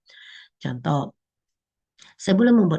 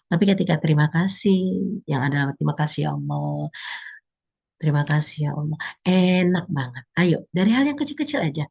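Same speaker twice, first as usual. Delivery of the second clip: ordinary delivery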